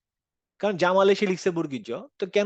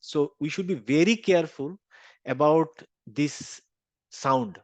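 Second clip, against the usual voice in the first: slow warning; much slower than usual. Real, not acted. Teacher warning slowly.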